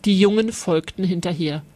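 ordinary delivery

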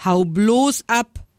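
rude. A narrator's reading. Monkey chases the lion away, rude. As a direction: send them packing, drawing out the words. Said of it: "sagte er grob".

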